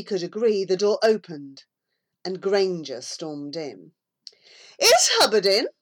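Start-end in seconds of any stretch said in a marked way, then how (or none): 4.8-5.7 louder curt bark; barked question. A narrator's reading. Bully storming into the shop, louder barked question. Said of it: "he barked".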